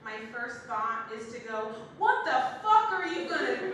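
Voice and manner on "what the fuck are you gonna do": loud, high, laughing disbelief; mock exasperated. Comic blurting it to a pregnant friend, loudly incredulous.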